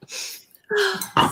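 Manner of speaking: high, hammed-up crying voice; overacted award-speech sob; mock tearful acceptance. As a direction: fake tears through the thank-yous. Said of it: "I'm about to cry".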